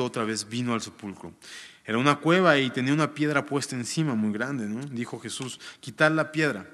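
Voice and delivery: formal reading of the Gospel; scripture reading. Acted. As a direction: read the passage out to the congregation. Reads scripture aloud.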